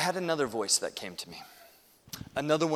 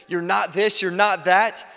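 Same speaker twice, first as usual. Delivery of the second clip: louder, higher-pitched accusations; accusing inner voice; 6 semitones higher, 9 dB louder, slower than usual. Acted. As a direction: hammer out each charge. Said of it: "that voice that says".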